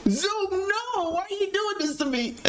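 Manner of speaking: very high, squealed protest; mock freak-out. Streamer reenacting panic at Zoom, mock freak-out. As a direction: wail at it in hammed-up despair.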